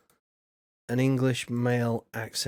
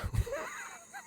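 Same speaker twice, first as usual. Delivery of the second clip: high squeaky laugh; 13 dB quieter than usual. Real, not acted. Amused by a viewer message.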